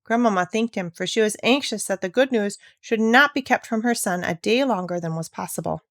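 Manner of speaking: ordinary delivery, nothing marked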